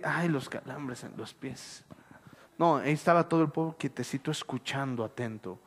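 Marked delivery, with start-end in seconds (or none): 0.0-1.8 soft, slow grumble about sore feet; mock complaint. Preacher mimics a restless congregant, soft slow whine. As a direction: whine it quietly like a tired listener. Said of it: "y ya están de que".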